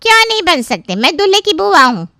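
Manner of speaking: high, loud indignant auntie; indignant protest. Acted. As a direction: demand it as your due, loud and high. Aunt protests being refused food.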